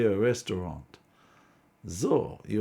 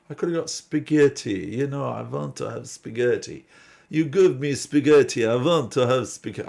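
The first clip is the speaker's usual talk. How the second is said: a light hammed-up Italian accent, higher and louder; mock Italian accent; 4 semitones higher, 7 dB louder than usual. Ordering at the Italian restaurant, a mock Italian accent. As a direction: play at an Italian waiter ordering spaghetti. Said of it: "it's the wrong accent, I think".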